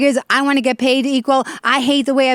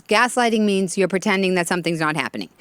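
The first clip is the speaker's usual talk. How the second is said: ordinary delivery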